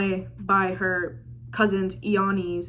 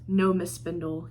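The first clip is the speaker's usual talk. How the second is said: brooding captain; 4 semitones lower, 7 dB quieter than usual. Retold or imitated. Brooding Captain answers the heroine.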